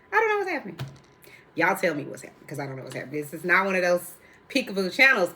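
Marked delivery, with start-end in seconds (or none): 0.1-0.8 much higher, bewildered; high exasperation. Real, not acted. Host squeals in confusion about her shirt. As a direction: throw it up high in disbelief. Repeated line two ways.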